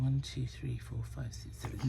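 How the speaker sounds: soft count dropping to a whisper; tally them quietly to yourself; counting under breath. Real, not acted. Counting again to find her size.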